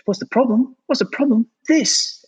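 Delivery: high, squeaky mock voice of an outraged shop clerk; mocking the shop guy. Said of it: "And he goes".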